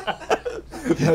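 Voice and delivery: high burst of laughter; real high laugh. Real, not acted. Teller cracks up retelling the story, high laugh.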